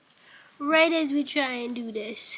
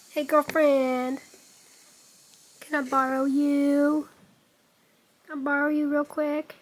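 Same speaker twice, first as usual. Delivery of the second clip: coaxing; 3 semitones higher, 5 dB louder than usual. Real, not acted. Coaxing cat, slow.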